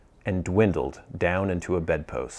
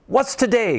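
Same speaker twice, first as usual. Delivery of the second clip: calling down; 14 semitones higher than usual. Acted. Scrooge calling from his window to a boy below. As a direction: call down to the street. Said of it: "cried Scrooge, calling downward".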